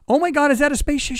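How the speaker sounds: high-pitched, astonished question; imitated shock. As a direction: mimic her startled cry, voice way up. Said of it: "she's actually shocked".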